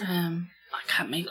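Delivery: soft unvoiced aside; whisper. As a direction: breathe it like a secret. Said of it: "continues whisper".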